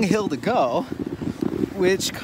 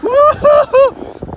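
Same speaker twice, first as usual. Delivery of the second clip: high, slow loud whoop; 7 dB louder, slower than usual. Real, not acted. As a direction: whoop out with joy. Sledding on past curb.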